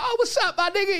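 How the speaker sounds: high, laid-back mock reply; imitating Todd. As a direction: play it cool but way up in pitch. Voicing Todd's casual reply.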